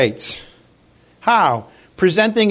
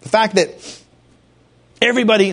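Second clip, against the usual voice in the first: ordinary delivery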